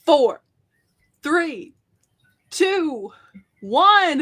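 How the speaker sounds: countdown rising in pitch; excited countdown. Real, not acted. Counting down the draw.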